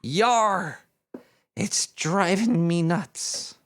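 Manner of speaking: slow pirate growl of a punchline, yar stretched; slow hammed-up pirate punchline. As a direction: land the joke like a hammy pirate. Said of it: "The pirate looks at the shrink and says".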